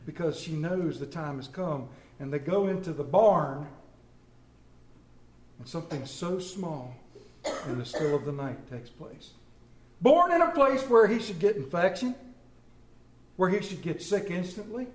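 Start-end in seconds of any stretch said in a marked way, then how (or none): 5.6-9.3 softly, holding back the voice; hushed awe. Acted. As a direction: let the words sink into stillness. Solemn hush over the nativity.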